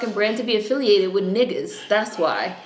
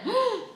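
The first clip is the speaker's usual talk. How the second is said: high breathless gasp with a nervous laugh; sharp gasp; 5 dB quieter than usual. Real, not acted. Reacting to the show, a high laughing gasp. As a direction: suck in air in disbelief. Shocked while watching a show.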